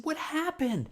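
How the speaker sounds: startled high question; confused kid. Retold or imitated. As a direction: pipe up like a bewildered little boy.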